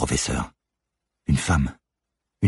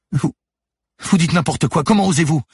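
much higher, louder indignant cry; outraged shout; 12 semitones higher, 5 dB louder than usual. Acted. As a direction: fling it back at her. Manon outraged at Lucie.

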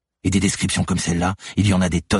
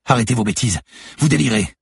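higher, louder outraged cry; 7 semitones higher, 4 dB louder than usual. Acted. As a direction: shout her down in panic. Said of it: "s'écria".

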